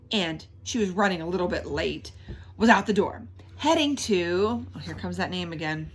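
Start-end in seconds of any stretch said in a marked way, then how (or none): none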